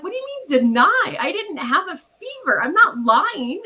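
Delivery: high, indignant protest, slowing at the end; defensive. Retold or imitated. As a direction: push back hard, insisting you're telling the truth. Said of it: "got really defensive".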